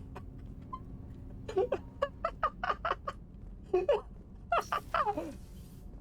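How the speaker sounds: high, airy cackle, clearly put on; fake laugh. Asked for a fake laugh, high and airy. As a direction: force out an exaggerated fake laugh. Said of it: "Is that what you wanted?".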